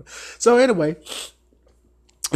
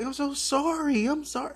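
higher, softer sorry; lightly voiced apologetic queen; mock apologetic drag queen; 10 semitones higher, 6 dB quieter than usual. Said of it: "She's like".

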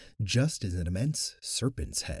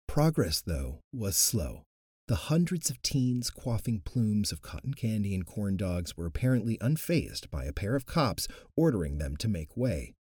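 ordinary delivery

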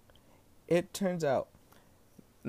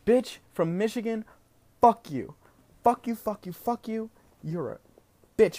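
higher, repeated "fuck you" at the Michigan driver; cursing out; 6 semitones higher than usual. Real, not acted. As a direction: spit it at the absent driver.

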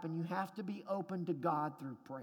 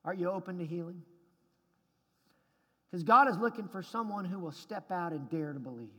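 normal talking voice

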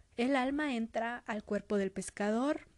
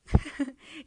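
laugh; 4 semitones higher, 8 dB louder than usual. Real, not acted. Laughs at the splat.

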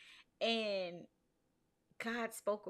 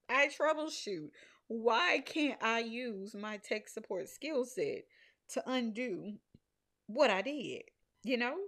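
higher, louder exasperated plea; frustrated prayer; 5 semitones higher, 4 dB louder than usual. Retold or imitated. Venting to God, fed-up with the website, exasperated. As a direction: vent at God like a fed-up pro. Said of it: "I'm mad at God".

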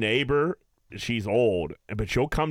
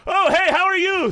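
high, loud pretend greeting; fake neighbor greeting; 7 dB louder than usual. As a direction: greet an imaginary neighbor brightly. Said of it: "I was like".

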